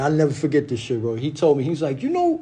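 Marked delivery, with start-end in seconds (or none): 2.0-2.4 higher, teasing mentor voice; mentor's mocking voice. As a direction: hams up the older mentor's roast. Mentor roasting him, voiced high.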